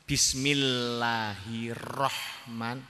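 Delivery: "Bismillahirrahman" very slow, doubled l and r held long; doubled consonants stressed. Shown as a demonstration. Teacher counting doubled letters, "Bismillahirrahman" drawn out slowly on each. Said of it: "Tasdidat teng Fatihah yang empat belas".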